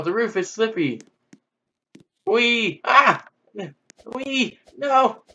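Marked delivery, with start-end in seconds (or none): 2.3-5.2 high excited squeals and cries; high-pitched wee and no. Real, not acted. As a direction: squeal all the way down. Sliding on slippery roof.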